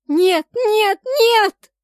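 very loud, high cries of refusal, climbing; frantic full-voiced shriek; screaming. A narrator's reading. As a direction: scream it to drown out the voice. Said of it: "громко закричала я".

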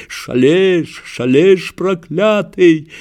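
high, slow furious yell at the enemy; angry shout. A narrator's reading. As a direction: scream it out in rage. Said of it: "злобно закричал раненый".

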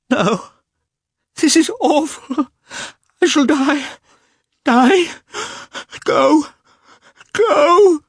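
very high, loud screaming wail; anguished cry. A narrator's reading. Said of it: "she cried".

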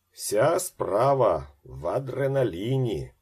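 loud, slow, high rolling delivery; booming jovial doctor. A narrator's reading. Egyptian doctor marvels at his patient, booming and jovial. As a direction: boom it out slowly with big swings. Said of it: "розкотисте сміявся кучерявий лікар-єгиптянин".

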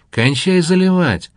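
ordinary delivery

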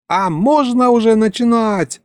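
high, eager character wail; high-pitched yell. A narrator's reading. Елька cries out to ask about starting, high yell. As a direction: pitch it way up as a hammed-up holler. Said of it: "снова завопила Елька".

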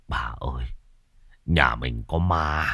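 nhỏ, thều thào run sợ; thì thào yếu ớt vì sợ ma. Acted. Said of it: "mới thều thào". (chồng thều thào với vợ, nhỏ và run sợ)

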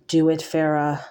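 usual speaking voice

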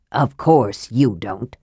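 slow, low caustic retort. A narrator's reading. Mage answers the impatient knight, caustic. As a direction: dismiss him with dry, low scorn. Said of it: "Raistlin returned caustically".

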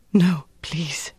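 breathy, pleading whisper; frightened whispered plea. A narrator's reading. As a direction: beg under your breath, voice gone. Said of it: "she whispered".

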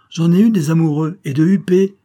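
high, loud, proud retort; proud boast. A narrator's reading. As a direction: draw yourself up and boast. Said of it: "dit Céline en se redressant". (fière vantardise)

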